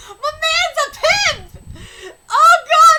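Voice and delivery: high, loud cheering through laughter; excited shout. Real, not acted. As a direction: hoot it with gleeful pride. Celebrating character's chocolate.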